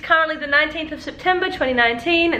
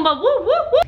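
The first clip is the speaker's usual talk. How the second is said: 1 playful whoops; 11 semitones higher than usual. Real, not acted. Playful vocal noise, laughing.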